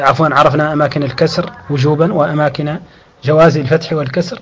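ordinary talk

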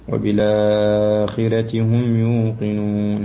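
وبالآخرة slow, vowel held medium length; medium elongation. Shown as a demonstration. Teacher showing medium elongation for Warsh. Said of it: "التوسط".

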